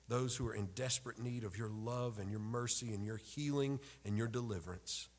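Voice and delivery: ordinary talk, nothing marked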